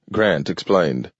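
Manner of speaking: ordinary delivery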